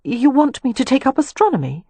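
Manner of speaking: high-pitched, incredulous question; bewildered. A narrator's reading. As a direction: ask as if completely baffled by the idea. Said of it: "she asked in bewilderment".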